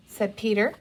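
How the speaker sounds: ordinary delivery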